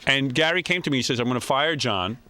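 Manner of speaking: usual speaking voice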